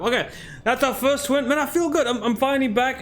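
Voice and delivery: excited. Real, not acted. First win after a long break.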